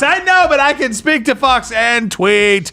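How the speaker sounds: high, slow, stubborn Trump; mock Trump insisting; Trump impression. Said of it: "he's like".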